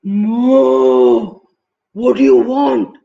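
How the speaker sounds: loud, long moo running into the words; booming mooing buffalo voice. A narrator's reading. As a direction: bellow it like a cow disturbed. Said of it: "And the buffalo says".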